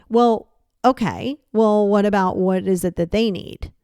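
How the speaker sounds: ordinary talk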